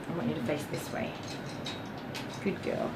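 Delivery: gentle pet talk while grooming; soft dog praise. Real, not acted. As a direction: murmur it to the dog so she stays calm. Directing the dog on table.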